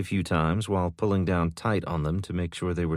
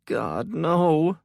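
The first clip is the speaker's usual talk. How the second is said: horrified; 10 semitones higher, 4 dB louder than usual. A narrator's reading. Mother sees disaster.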